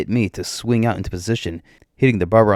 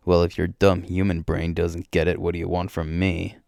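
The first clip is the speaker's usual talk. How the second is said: usual speaking voice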